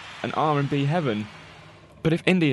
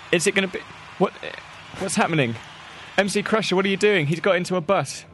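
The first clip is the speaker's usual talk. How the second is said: alarmed shout; 8 semitones higher than usual. Acted. Pleading with the bus driver.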